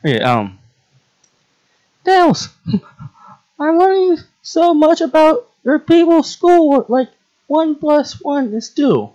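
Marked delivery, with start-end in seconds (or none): 2.1-9.1 put-on very high, squeaky girl voice; plant girl character. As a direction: pipe up high as an eager little pupil. Plays the plant girl learning math.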